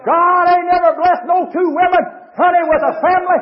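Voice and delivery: high, loud, rising sermon cadence; shouted hellfire-and-brimstone delivery; full-force preaching. Acted. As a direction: preach it hard to the whole church. Preacher denounces from pulpit.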